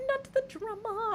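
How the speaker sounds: high, slow not the drama; high drawn-out catchphrase. Acted. Streamer reacting to chat, high sing-song. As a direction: lift it up and drag it. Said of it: "catchphrase repeat".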